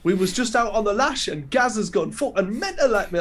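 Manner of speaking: high, loud Geordie reality-star voice; Geordie accent. Retold or imitated. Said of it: "they talk like this".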